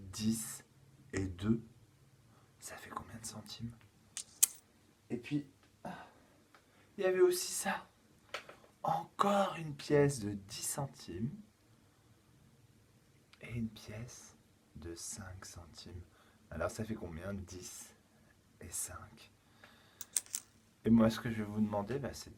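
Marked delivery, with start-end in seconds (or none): 2.6-3.7 put-on hushed question to the children; soft secret whisper. Teacher hushing a counting question. 8.8-16.0 slow whispered counting of coins; slow hushed secret voice. Acted. Teacher revealing coins in secret. 18.6-19.3 whispered sum. Acted.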